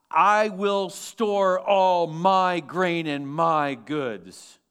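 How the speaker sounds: drawn-out, very slow reading, leaning on "I" and "my"; emphatic self-centred reading. Acted. Acts out self-centred rich man's verse.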